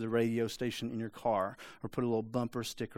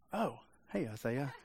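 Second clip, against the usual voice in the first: a hint of an offhand God, softer, with a little laugh; casual God; 8 dB quieter than usual. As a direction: greet him like you just noticed him, laughing lightly. God casually spots Isaiah.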